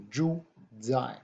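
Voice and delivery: zhu zai said slowly, zh like j, z like z. Shown as a demonstration. Teacher slowly modelling zhu zai for online students. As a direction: draw out zhu zai so the j and z sounds are clear. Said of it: "the Z H is a j sound".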